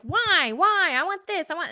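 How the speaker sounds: put-on high, pleading whine of little kids; whiny kids. Mom imitating her kids whining for something, high and pleading.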